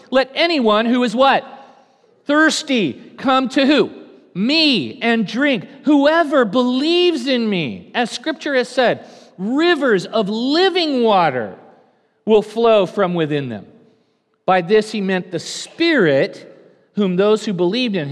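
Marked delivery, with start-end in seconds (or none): 0.1-7.9 high, loud and slow proclamation. Acted. Jesus calling to the festival crowd, loud and slow. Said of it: "he stood and said in a loud voice". 9.4-13.7 high, loud, slow scripture; loud proclaiming. Acted. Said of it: "he stood and said in a loud voice".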